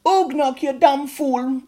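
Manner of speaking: a high, loud scolding mother's voice; strict goblin mother. A narrator's reading. Mother goblin telling off Ugnok, high loud scold. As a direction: scold him like a strict mum. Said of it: "this is what she said to Ugnok".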